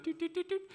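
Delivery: high electronic beeping noises; beeping out the alarm code; keypad beeps. Retold or imitated. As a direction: mimic the alarm keypad tones.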